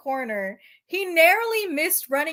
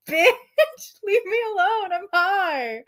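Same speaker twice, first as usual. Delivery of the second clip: laughing; 6 semitones higher than usual. Real, not acted. Reading while laughing.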